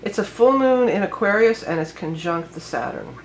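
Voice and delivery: normal talking voice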